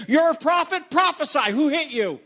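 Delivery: high, mocking taunt; taunting soldiers. Preacher voicing centurions taunting the blindfolded Jesus, mocking. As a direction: jeer like the soldiers beating him. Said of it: "punching him and saying".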